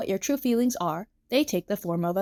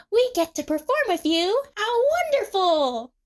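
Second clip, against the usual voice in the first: thrilled, high-pitched gush; Emu; 12 semitones higher, 4 dB louder than usual. A narrator's reading. Emu learns she gets to perform, thrilled. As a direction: squeal with delight, drawing out the last word.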